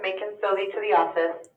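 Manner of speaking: ordinary talk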